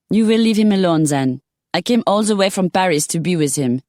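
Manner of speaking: a Parisian lady laying claim; French accent. Acted. Simone warns, French accent.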